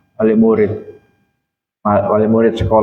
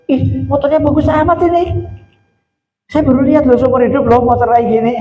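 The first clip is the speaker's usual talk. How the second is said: high-pitched, over-excited gushing; gushing guest; 11 semitones higher than usual. Acted. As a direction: squeal in mock amazement, voice pitched way up. Mimics guest gushing over motorbike.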